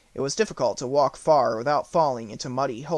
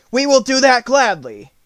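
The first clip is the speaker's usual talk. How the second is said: higher, louder glad tiger; eager tiger, much louder; Tiger's eager voice; 10 semitones higher, 10 dB louder than usual. A narrator's reading. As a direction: agree eagerly.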